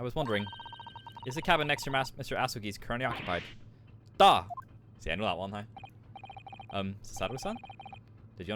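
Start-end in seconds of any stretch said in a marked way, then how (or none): 4.2-4.4 short loud Russian "Da!". Acted. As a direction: bark "Da!" like a Russian sailor. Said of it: "probably Russian for yes".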